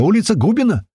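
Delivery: high, excited answer; blurted out. A narrator's reading. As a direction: shout back the street in delight. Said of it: "выпалил Лёха".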